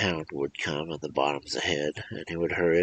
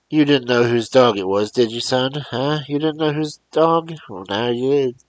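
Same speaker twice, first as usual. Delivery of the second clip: higher, loud, mocking questions; taunting; 7 semitones higher, 6 dB louder than usual. Old man taunting the boy about the dog, mockingly raised. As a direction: needle the boy.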